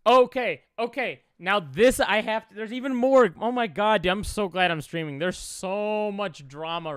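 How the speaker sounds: light up at fresh drama; excited. Real, not acted.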